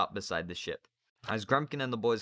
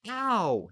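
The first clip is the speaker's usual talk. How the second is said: pained yelp; 13 semitones higher, 7 dB louder than usual. Acted. Boy yelps when cuffed.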